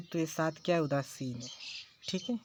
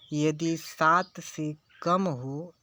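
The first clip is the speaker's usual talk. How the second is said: slow, deliberate repeat of सात से कम हो; slow emphasis; much slower than usual. Shown as a demonstration. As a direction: drag out सात से कम हो for the class. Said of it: "teacher repeats rule slowly". (धीमे, ठहरकर ज़ोर देते हुए)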